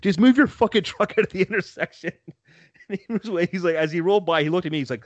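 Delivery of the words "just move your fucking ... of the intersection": a mild retold yell, higher, laughing; mock yell. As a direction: re-enact the yell lightly through a laugh. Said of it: "we hear you yell at this guy".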